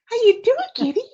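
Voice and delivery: high cooing pet voice. Real, not acted. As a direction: coo at the kitty, loud and high. Talking to her cat.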